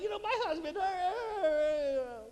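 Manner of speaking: high, hammed-up crying wife; mock wailing. Woman complaining to a friend about her husband, mock wailing. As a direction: dissolve into drawn-out fake sobs.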